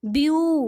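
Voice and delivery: View set apart and punched; stressed syllable. Shown as a demonstration. Teacher breaking down beautiful for students, view stressed. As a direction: isolate view with firm emphasis. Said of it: "ब्यू पे हमने जो है वो stress दिया".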